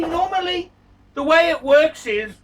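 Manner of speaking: usual speaking voice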